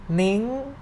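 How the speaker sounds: "Ning" high and climbing. Shown as a demonstration. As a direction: stretch "Ning" upward. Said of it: "represent the high sound".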